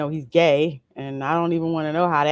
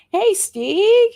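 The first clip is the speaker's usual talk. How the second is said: higher, louder, drawn-out hello; light up for a regular viewer; excited greeting; 14 semitones higher, 7 dB louder than usual. Real, not acted. Greeting a viewer named Steve, slow and excited.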